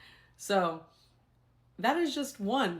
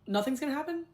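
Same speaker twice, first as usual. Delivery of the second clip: incredulous question; 8 semitones higher than usual. Retold or imitated. Teacher to admins about no punishment, incredulous. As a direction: pitch climbing high into disbelief. Retold disbelief at admin's inaction.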